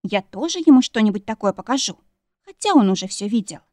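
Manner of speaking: usual speaking voice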